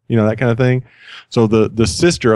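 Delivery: ordinary talk, nothing marked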